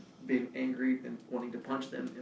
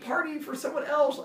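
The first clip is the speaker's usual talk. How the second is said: high-pitched self-defence; defensive protest; 13 semitones higher than usual. Real, not acted. Storyteller answers listener asking why so much beer, high defensive protest.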